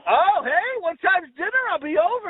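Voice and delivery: high, cheery bid for a dinner invite; chummy joking call. Retold or imitated. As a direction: beam it at a passing stranger. Joking to old lady with turkey.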